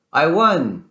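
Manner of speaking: triumphant shout. Acted. Lightning cheering his win at the finish line, triumphant shout. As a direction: shout out the win like you just crossed the line. Victory cry after race.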